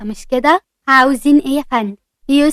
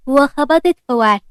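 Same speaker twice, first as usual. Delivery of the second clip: usual speaking voice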